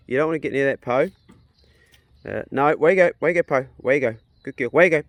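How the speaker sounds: loud call to a dog. Real, not acted. Calling out to dog Poe.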